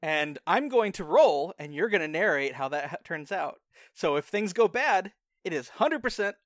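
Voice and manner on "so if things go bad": ordinary talk, nothing marked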